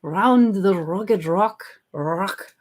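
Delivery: slow, badly faked Scottish accent; amateur imitation of a Scottish accent; attempted Scottish accent. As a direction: have a halting go at a Scottish tongue twister. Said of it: "talk with a Scottish accent".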